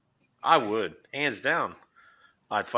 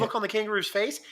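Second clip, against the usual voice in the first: ordinary delivery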